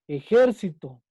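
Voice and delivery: "ejército" with JÉR punched loudly, then falling; stress on antepenultimate syllable. Shown as a demonstration. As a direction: hit JÉR hard in "ejército". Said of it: "Su sílaba tónica está en la antepenúltima".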